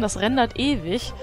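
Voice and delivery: ordinary delivery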